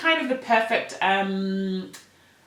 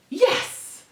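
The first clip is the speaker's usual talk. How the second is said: excited yes; 12 semitones higher than usual. Real, not acted. Cheers at reaching the end.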